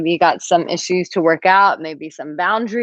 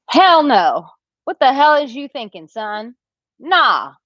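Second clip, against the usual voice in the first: emphatic hell no; 7 semitones higher than usual. Real, not acted. Host scolding a viewer about dating a married ex, emphatic. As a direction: scold him like a big sister.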